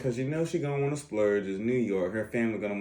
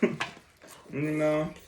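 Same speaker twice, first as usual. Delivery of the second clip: ordinary talk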